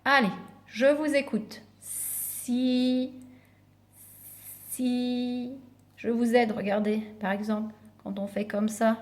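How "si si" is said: "Si" held long and soft, left hanging; soft, sustained "si", repeated after a pause; drawn-out syllable. Shown as a demonstration. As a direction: stretch out "si" as a prompt. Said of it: "commencent par la syllabe si".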